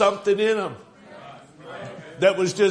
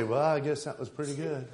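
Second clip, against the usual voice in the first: softer, underwhelmed imitation with a laugh; mock unimpressed; 2 semitones lower, 7 dB quieter than usual. Fan reacting to his team's home run, mock unimpressed. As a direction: play a fan barely moved, quietly. Mocking a fan's tepid reaction.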